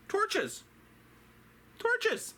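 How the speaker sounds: high, emphatic answer; excited exclaim. Acted. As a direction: announce it with a flourish. Excited answer, big pitch jump.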